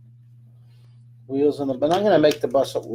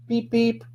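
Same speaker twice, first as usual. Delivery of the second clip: high playful beep beep like a toy horn; bus horn beep; 8 semitones higher than usual. Pretend. Drawing a bus for viewers, playful high horn noise. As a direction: toot it like a cartoon bus.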